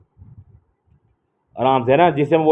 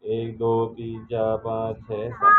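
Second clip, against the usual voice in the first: 1 soft counting; 4 semitones lower, 8 dB quieter, much slower than usual. Shown as a demonstration. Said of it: "teacher counts items aloud".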